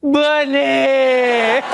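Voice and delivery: high, drawn-out mock lament; comic wailing cry. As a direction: call out the name in hammed-up despair. Comic lament calling out name.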